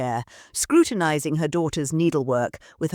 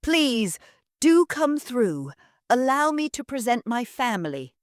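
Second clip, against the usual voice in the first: syrupy welcome for the lord; simpering hostess; 7 semitones higher than usual. Acted.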